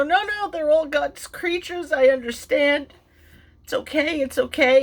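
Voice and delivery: lightly voiced man calming someone down; soothing neighbor. Neighbor soothing crying woman.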